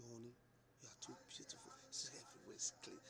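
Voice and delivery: soft, breathy whisper of praise; whispered sweet talk. Retold or imitated. Husband whispering praise to his wife after the cleaning. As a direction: whisper the compliment close to her.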